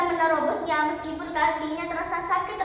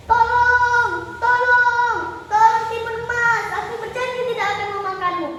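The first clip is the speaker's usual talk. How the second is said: pleading for help; 7 semitones higher, 7 dB louder than usual. Acted. Drowning giant struggles and begs Timun Emas for help, hollering. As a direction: holler desperately, stretching each cry. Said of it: "meronta-ronta meminta tolong".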